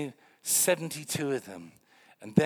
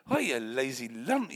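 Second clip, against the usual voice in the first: ordinary delivery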